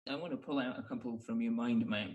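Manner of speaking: normal talking voice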